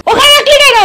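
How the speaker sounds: sharp high-pitched scolding question. Real, not acted. Aunt scolding the girl about where she set the plate, high and sharp.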